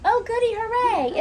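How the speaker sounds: high, gleeful cheer; excited duckling. Acted. Ducky Duckling sees it's raining today, excited.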